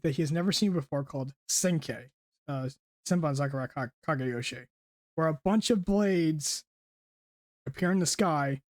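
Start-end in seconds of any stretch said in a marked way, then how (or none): none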